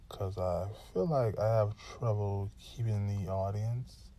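ordinary talk, nothing marked

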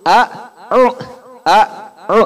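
a then u, separate; a and u apart. Shown as a demonstration. Teacher breaking down a'udzu. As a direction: split a'u into a, u. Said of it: "Jadi sebenarnya a'udzu".

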